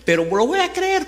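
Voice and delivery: high-pitched, acted concession; conceding. Peter answering Jesus that he will believe, conceding in a high voice.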